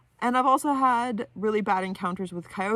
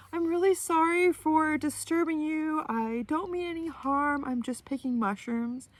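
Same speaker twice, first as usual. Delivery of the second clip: calm, gentle voice; 9 semitones higher than usual. Retold or imitated. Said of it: "in a really calm, gentle voice".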